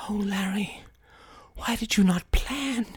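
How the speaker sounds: Kay whispering in shock; hushed dismay. Acted. Kay whispers to Larry in shock at the loss.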